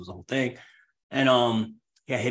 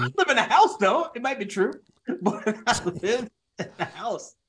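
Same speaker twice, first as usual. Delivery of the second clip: laughing; 8 semitones higher than usual. Real, not acted. Laughing defense against joke.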